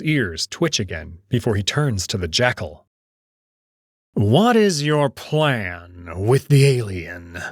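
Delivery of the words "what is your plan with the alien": slow, cold question; stern old wolf. Acted. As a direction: press him coldly.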